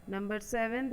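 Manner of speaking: usual speaking voice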